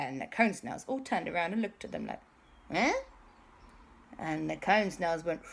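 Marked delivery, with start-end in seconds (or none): 2.7-2.9 short rising "eh?"; puzzled grunt. Acted. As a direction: react as a puzzled creature. Snails look round puzzled.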